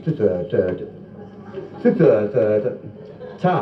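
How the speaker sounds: halting comic stutter with long gaps; mock stammer; bad speech impediment. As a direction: get stuck on T before one short word. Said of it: "His speech wasn't that bad".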